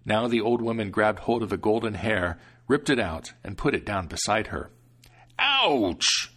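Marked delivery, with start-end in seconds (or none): 5.4-6.3 high, loud pained yelp; devil's yelp. Acted. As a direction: yelp at the pulled hair. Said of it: "screamed the devil".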